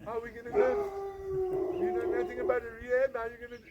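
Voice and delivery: high, agitated volley of questions; retold outburst of alarmed disbelief; incredulous wife. Wife's incredulous reaction, retold.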